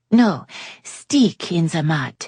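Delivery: put-on slow French-accented correction; French lady correcting herself. A narrator's reading. Yasmin correcting her phrase slowly, French-tinged.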